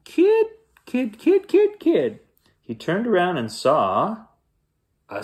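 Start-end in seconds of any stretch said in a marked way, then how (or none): 0.1-2.2 tiny squeaky falsetto animal; piping squirrel voice; high voice. A narrator's reading. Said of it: "saying in a high voice".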